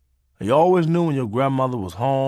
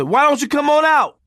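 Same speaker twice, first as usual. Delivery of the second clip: coaxing call; 13 semitones higher, 6 dB louder than usual. Retold or imitated. Coaxing through door.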